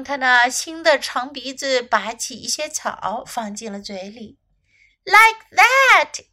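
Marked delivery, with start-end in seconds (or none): 5.0-6.2 high, loud storybook snake cry; emphatic snake calling out; Snake exclaiming. A narrator's reading. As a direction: exclaim it high, with a swooping fall. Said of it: "cried Snake".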